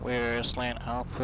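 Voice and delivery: ordinary talk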